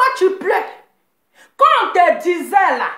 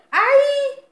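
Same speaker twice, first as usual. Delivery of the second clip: mock cry of pain; 10 semitones higher than usual. Mocking the crying woman.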